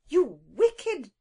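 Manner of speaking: put-on indignant cry at the intruding children; crying out in anger. A narrator's reading. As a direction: snap at the naughty children. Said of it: "she cried".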